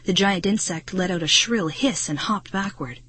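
ordinary delivery, nothing marked

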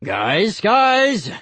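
rising to a high, loud call; breaking up a fight. A narrator's reading. Uncle pulls apart fighting kids, calling out. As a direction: call out to stop them, building.

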